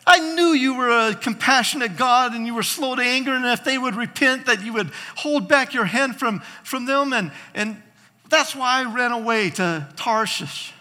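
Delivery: high, loud tantrum as Jonah; worked-up, indignant retold rant; throwing a fit. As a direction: protest to God like a sulking prophet. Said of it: "He throws a fit that".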